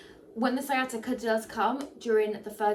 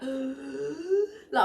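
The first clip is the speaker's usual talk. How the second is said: mock gasp for air; 4 semitones higher than usual. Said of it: "I'm constantly like".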